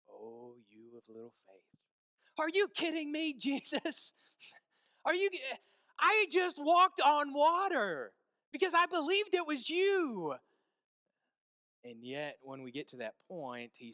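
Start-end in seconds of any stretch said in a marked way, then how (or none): 0.1-1.8 low, hushed, tender chiding; very gentle. Retold or imitated. The Lord reaching down to the sinking man, very gentle. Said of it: "in the gentlest way possible". 2.4-10.4 high, loud, incredulous outburst; indignant protest. Retold or imitated. Peter to Jesus after walking on water, indignant shouting.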